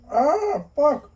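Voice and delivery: sudden loud cry of pain, pitched high; pained yelp. Real, not acted. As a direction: yelp as hot broth hits your arm. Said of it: "spicy ramen on my forearm".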